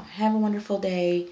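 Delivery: a patient signing off a voicemail, sweetly; super nice. Retold or imitated. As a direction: lay the friendliness on thick. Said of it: "Super nice".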